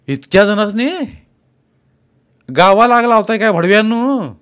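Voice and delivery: high, loud exasperated yell at the workers; angry shout. Acted. Landlord yelling at his idle farmhands, angry and loud. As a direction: bellow at them like a furious landlord. Boss scolds workers over matchboxes.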